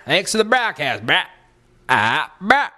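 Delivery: high, exaggerated bro sign-off; high mock bro-host voice; bro show host. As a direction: wrap up like a hammed-up bro. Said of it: "then I'll be like".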